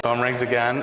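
ordinary talk

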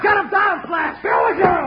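high, urgent yell; shouted warning. Acted. Sidekick warning Bill in a gunfight, high urgent shout. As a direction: shout it out in alarm.

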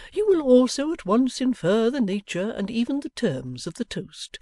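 put-on a fond father orating to his children; father's grand toast. Pa makes a formal speech.

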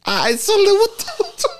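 high, laughing through the words. Retold or imitated. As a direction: giggle through the retold reply. Retold own reply, laughing.

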